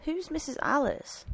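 mildly rattled, trying not to show it; unsettled, concealing it. A narrator's reading. Priest asking about the other woman, masking unease. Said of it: "unsettled, but trying not to show it".

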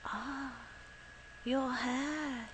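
soft, high, drawn-out murmur; hushed awe, not a full whisper. A narrator's reading. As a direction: breathe it out gently in wonder. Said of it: "he whispered".

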